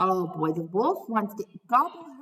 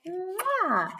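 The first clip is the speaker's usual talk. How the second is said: kiss sound; 9 semitones higher than usual. Acted. Little Red kissing her mother goodbye, smacking kiss. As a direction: blow a big kiss.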